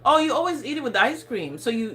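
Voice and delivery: normal talking voice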